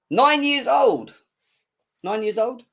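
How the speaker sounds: high, slow, stretched-out disbelief; mock astonishment. Real, not acted. Taunting a young rival fan, mock disbelief. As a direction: drag out nine in mock shock. Mocking a rival fan's age.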